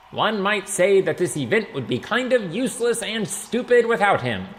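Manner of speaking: high, loud stage-speech voice; mayor addressing a crowd. A narrator's reading. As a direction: play to the crowd from the podium.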